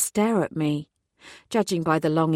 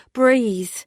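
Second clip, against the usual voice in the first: louder, firm order to breathe; firm command; 5 semitones higher, 6 dB louder than usual. A narrator's reading. Detective calming a panicking man, commanding. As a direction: take charge of his breathing. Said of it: "I commanded".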